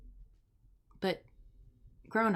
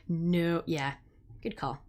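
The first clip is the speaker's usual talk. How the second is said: ordinary talk, nothing marked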